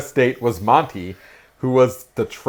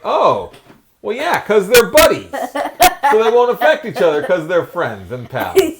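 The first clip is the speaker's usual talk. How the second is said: mock delight; 11 semitones higher, 8 dB louder than usual. Retold realization, mock reasoning.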